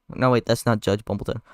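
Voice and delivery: usual speaking voice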